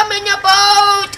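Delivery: high, loud joyful cry of I'm in a boat; excited shout. Real, not acted. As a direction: shout it out, thrilled. Repeats line, second drawn out.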